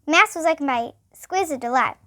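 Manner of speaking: plain reading voice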